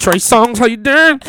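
playful come-on hello; mock flirty greeting. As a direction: call out to the photo like a pickup line. Greeting the photo again.

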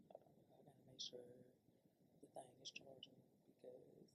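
muttered whisper to self. Real, not acted. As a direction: mumble it under your breath. Muttering to self about charger.

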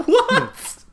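high, loud, stunned; baffled. Retold or imitated. Caller confused by the old promoter, baffled. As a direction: squeak it out in disbelief. Bewildered reaction to the call.

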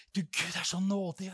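ordinary delivery

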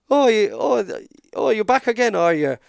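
high, loud Irish traveller taunt; mock the wrestler in an Irish traveller accent. Travellers taunting the lying wrestler, in an Irish accent. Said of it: "I don't wanna keep doing the impression".

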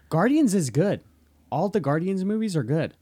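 defending the Guardians movies, loud and firm; emphatic. Real, not acted. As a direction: insist on it firmly.

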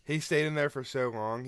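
ordinary talk, nothing marked

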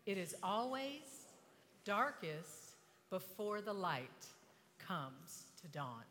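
slow, measured quote with pauses; slow recitation. Shown as a demonstration. Preacher leads the audience in quoting a saying, slow recitation. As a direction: recite it slowly, phrase by phrase.

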